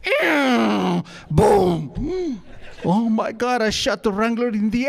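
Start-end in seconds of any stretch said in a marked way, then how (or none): 0.0-1.0 louder imitated horse squeal; a short whinnying cry; horse noise. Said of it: "the horse goes". 1.3-2.4 louder mock gunshot boom; a sudden spoken bang. Gun goes off.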